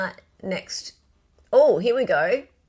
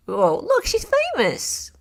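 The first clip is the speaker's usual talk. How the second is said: mock excitement; 12 semitones higher, 4 dB louder than usual. Mocking remark.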